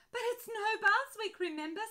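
high, loud spluttered protest; spluttering protest. Acted. Ben protests to Mum about bath week, loud spluttering. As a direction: splutter out the objection in alarm. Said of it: "spluttered Ben".

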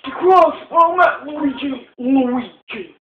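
put-on high, loud old professor voice; old man voice. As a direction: read the lines as an excitable old man. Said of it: "this voice is getting annoying".

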